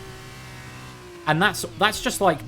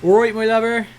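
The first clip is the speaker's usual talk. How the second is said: broad Cornish greeting, louder and higher; hearty Cornish dialect impression, pitched up; Cornish accent; 8 semitones higher, 7 dB louder than usual. Shown as a demonstration. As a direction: put on a thick West Country Cornish accent. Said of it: "I'm from Cornwall, which has its own accent".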